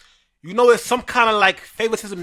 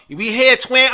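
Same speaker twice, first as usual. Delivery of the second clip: a mock brag, pitched up and a little louder; mocking Courtney; 6 semitones higher, 3 dB louder, slower than usual. Mimicking the rival player's boast at the Barclays, mock brag. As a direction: parrot the rival's boast with sarcasm. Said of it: "she came into the Barclays talking about".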